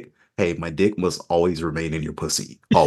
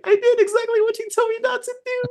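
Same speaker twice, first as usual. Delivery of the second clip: much higher squeaky apology; high-pitched voice. Retold or imitated. Narrator quoting rider's apology in high-pitched voice. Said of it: "Speaking in a high-pitched voice".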